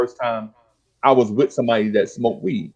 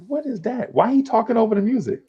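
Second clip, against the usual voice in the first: high, puzzled questioning; baffled; 11 semitones higher than usual. Retold or imitated. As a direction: sound thrown by this strange new sound. Hearing rap the first time.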